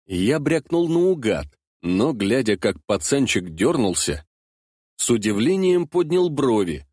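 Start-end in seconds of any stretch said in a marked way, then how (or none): none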